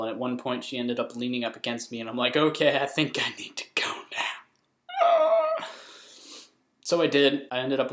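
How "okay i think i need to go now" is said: slow, strained excuse; mock-nervous, drawn-out retreat; uncomfortable exit. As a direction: edge away awkwardly, stretching the words. Retold uncomfortable escape.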